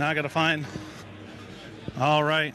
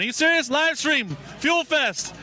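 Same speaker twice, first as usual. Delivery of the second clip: higher, pleading hype; excited pleading; 7 semitones higher, slower than usual. Real, not acted. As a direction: beg the crowd to cheer. Crowd not responding, pleading.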